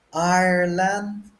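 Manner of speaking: Ireland slow and high; demonstrated word. Shown as a demonstration. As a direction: Ireland drawn out in two clear parts. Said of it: "we drop the land".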